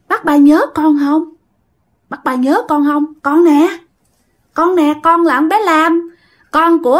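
put-on ghost girl. Dead girl reminding her old neighbour who she is, ghost girl voice. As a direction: press the old neighbour to remember you.

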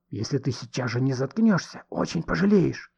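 tense threat with voice held down; menacing threat. A narrator's reading. Man warning the woman to shut up, menacing. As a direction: threaten her through gritted teeth.

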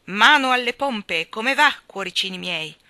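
put-on loud booming old sailor; cavernous admiral. A narrator's reading. The admiral hails the children, booming. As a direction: boom it like a sea dog. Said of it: "Una nota voce cavernosa".

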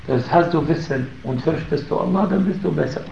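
usual speaking voice

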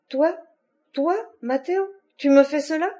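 high, incredulous questioning; stunned disbelief. A narrator's reading. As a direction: gasp it out as a shocked, wounded question. Slapped woman reacts in disbelief.

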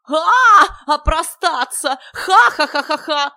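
high, very loud gleeful howl; yelling with laughter. A narrator's reading. Silly guest howls at the euphemism, high yelling laughter. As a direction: shriek the word, then cackle loudly. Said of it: "вопил Дурилов".

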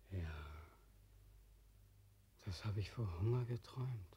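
ordinary talk

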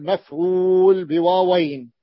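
ordinary talk

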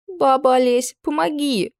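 high, tearful plea; a teenage girl begging for help; pleading girl. Acted. Tearful teen girl begging Baba Olesya for help. Girl's voice.

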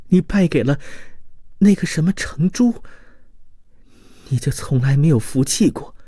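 from wistful memory into wounded reproach; grieving, devoted woman. Acted. (女子哀怨地追忆往事)

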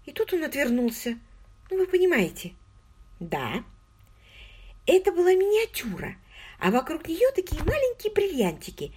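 put-on high, eager girlish voice; an excited young woman confiding. A narrator's reading. Margot voiced by the narrator.

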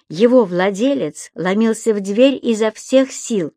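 plain reading voice

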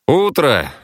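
ordinary delivery, nothing marked

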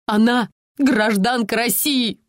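slow, insistent exclamation; exclaiming. Acted. Mother insists the police protect her daughter, mild exclamation. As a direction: press the point on the police. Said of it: "воскликнула Инна Владимировна".

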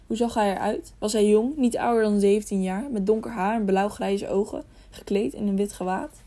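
usual speaking voice

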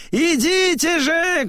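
very high, insistent call; high summoning cry; shouting. A narrator's reading. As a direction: urge them over in a piercingly high voice. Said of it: "кричит он".

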